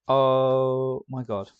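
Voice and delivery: loud, slow drawn oh my god; shocked gasp. Real, not acted. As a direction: gasp then drawn-out disbelief. Sudden realisation about frog.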